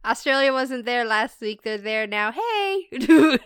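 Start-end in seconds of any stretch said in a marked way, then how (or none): 2.3-3.4 high-pitched drawn-out hey; cheery shout-out. Real, not acted. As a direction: greet the newcomers brightly, high. Greeting listeners in Australia.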